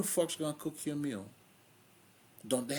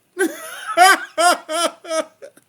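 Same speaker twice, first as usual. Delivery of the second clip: loud laugh; 17 dB louder than usual. Real, not acted. Cracking up at his story.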